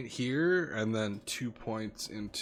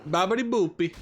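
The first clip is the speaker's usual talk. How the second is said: hammed-up Italian accent, higher and louder; sing-song cartoon Italian; mock Italian accent; 8 semitones higher, 6 dB louder than usual. Streamer hams up an Italian accent, high and loud. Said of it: "that was my Italian accent".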